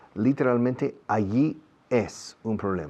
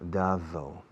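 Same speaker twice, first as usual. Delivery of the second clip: ordinary talk, nothing marked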